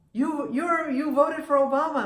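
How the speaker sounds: high, loud, stammered mock astonishment; retold amazed question. Kiosk man learns of the traveler's vote, amazed. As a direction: act out the kiosk man's astonished outburst.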